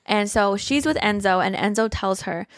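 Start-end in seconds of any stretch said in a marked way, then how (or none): none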